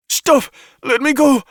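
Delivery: high, loud frantic shout; pinned woman shouting to be released. A narrator's reading. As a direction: struggle and scream to be freed. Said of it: "Xi shouted".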